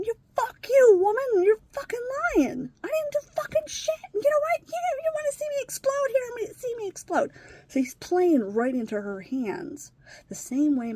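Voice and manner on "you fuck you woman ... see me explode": very high, loud imitation of a screaming outburst; retold losing it. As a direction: act out a boy exploding in rage. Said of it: "lose his fucking shit".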